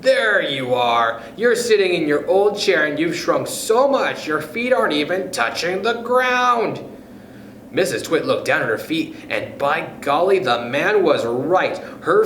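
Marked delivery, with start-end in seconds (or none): 0.0-6.8 gloating shout, rising higher to the end. Acted. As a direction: point and crow at her. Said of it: "pointed at her and shouted".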